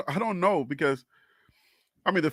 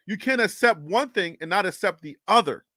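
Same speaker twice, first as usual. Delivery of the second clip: usual speaking voice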